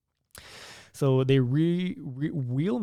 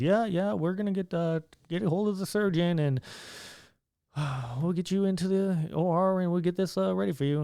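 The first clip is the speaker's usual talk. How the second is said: lightly imitated doctor, pitched higher; subdued hippie doctor; 6 semitones higher than usual. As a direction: break it gently as a deflated doctor. Same hippie doctor, acted.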